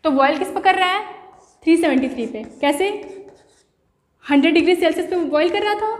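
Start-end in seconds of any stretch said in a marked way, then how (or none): none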